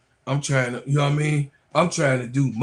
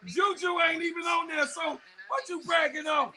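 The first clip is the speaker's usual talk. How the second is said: shouting at a rival fan; heated shouting; 13 semitones higher than usual. Real, not acted. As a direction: yell him down for bragging. Trash talking rival fan.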